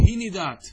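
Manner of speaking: هندات as "hi-ni-daat", i after the n; middle vowel i. Shown as a demonstration. Teacher gives three dialect forms to students, هندات as "hi-ni-daat". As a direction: say هندات with a kasra on the nun. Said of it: "بالكسر".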